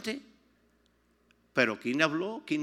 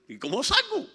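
mock panic; 12 semitones higher, 6 dB louder than usual. Retells being locked in.

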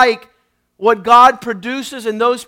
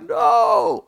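startled refusal; 9 semitones higher, 3 dB quieter than usual. Retold or imitated. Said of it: "I was like".

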